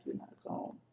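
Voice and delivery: a broken whisper of "You're not gone."; whispered plea. Retold or imitated. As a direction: whisper "You're not gone." softly and voicelessly into her ear. Talking in dead mother's ear.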